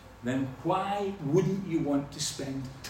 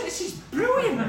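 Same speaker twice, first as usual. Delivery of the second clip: cows delighted, high; 4 dB louder than usual. Retold or imitated. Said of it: "They were just".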